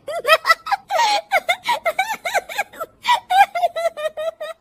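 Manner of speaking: high-pitched giggling fit; long peals of high, acted laughter; loud laughing. A narrator's reading. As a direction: giggle away helplessly at the TV. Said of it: "बहू के जोर जोर से हंसने की आवाज".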